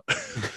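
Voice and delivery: short high laugh; high burst of laughter. Real, not acted. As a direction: laugh at your own story. Laughs at own story.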